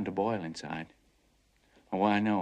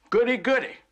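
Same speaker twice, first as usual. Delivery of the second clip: gleeful; 9 dB louder than usual. Acted. Mocking glee at adventures.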